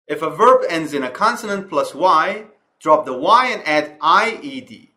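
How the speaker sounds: slow, careful reading of an English grammar rule. Acted. Teacher reading the slide rule to students, slow and clear. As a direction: read the rule out slowly and clearly, word by word.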